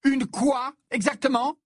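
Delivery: very high, challenging demand; high-pitched angry question; outraged cry. A narrator's reading. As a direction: demand an answer, incensed. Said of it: "s'écria Ginny".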